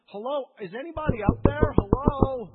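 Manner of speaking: loud, high call into emptiness. Retold or imitated. Believer calling loudly up to a silent God. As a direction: shout up as if nobody answers.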